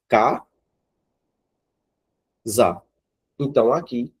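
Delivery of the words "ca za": Ca and za split with a long pause; Ca, then za, clearly apart; syllable by syllable. Shown as a demonstration. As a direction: break casa into its two syllables, slowly. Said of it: "ó".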